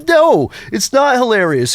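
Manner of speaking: high, emphatic mock protest; exasperated retort. Husband protesting to his amused wife, exasperated and high.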